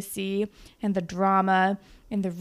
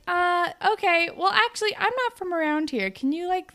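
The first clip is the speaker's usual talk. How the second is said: much higher, louder chirpy voice of a lost visitor; high tourist girl; 10 semitones higher, 4 dB louder than usual. Retold or imitated. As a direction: chirp the question like a clueless tourist. Tourist girl asks for directions.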